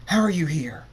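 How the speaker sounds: loud, high burst of disbelief; shocked outcry. A narrator's reading. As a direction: cry it out in shock. Shocked character confronts his double.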